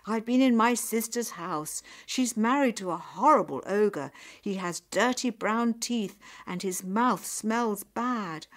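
loud, heated grumbling; indignant complaint. Acted. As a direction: rant about how badly you were treated. Nyap complains bitterly about her sister's house.

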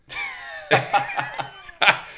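high, breathy burst of real laughter. Real, not acted. Host laughing while retelling the puppy surprise. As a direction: cracks up at his own story.

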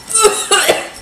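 spluttering cough as the heat hits; choking cough. Real, not acted. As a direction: cough hard, spice up the nose. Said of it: "Oh my God, it's coming out my nose".

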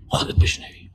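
breathy whisper, barely voiced. Shown as a demonstration. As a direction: whisper it as part of the demo. (نجوای آهسته)

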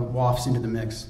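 plain reading voice

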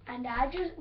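ordinary delivery

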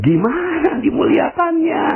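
very high, drawn-out mock wail; exasperated question. Listener asks preacher how to honour corruptors, exasperated. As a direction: stretch it into a high, baffled cry.

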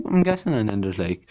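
usual speaking voice

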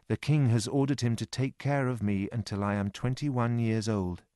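ordinary delivery

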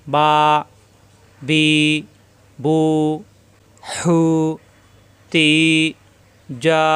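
each of বা বি বু হু তি যা with its vowel held long; stretched syllables. Shown as a demonstration. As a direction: draw out every syllable for one alif. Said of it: "এরকম".

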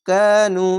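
কানু with the final উ held one alif; Quranic recitation. Shown as a demonstration. Teacher recites a word slowly for pupils. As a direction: stretch the উ of কানু evenly. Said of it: "ওয়াও সাকিন এক আলিফ টেনে পড়বেন".

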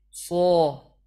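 صا with a loud full sad; first demo take of صا; sad sound, take one. Shown as a demonstration. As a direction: say صا crisp and forceful. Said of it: "صاد رو الان تلفظ کنم".

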